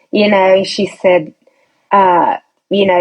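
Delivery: usual speaking voice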